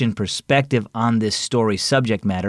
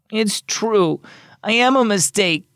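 put-on sniffling boy; 9 semitones higher than usual. A narrator's reading.

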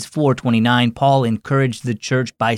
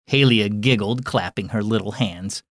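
usual speaking voice

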